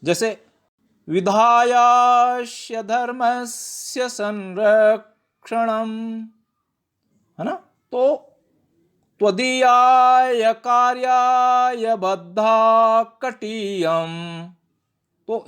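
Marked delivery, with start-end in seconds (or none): 1.1-6.3 slow chant with the long vowels in "विधायास्य धर्मस्य संरक्षणम" stretched; drawn-out Sanskrit recitation. Shown as a demonstration. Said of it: "दीर्घ करके उनका उच्चारण करना चाहिए". 9.2-14.5 slow and louder recitation with the long vowels in "त्वदीयाय कार्याय" stretched; stately slow Sanskrit chant; long vowels drawn out. Shown as a demonstration. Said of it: "दीर्घ करके उनका उच्चारण करना चाहिए".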